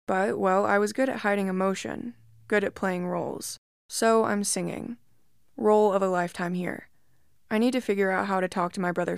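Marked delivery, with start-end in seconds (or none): none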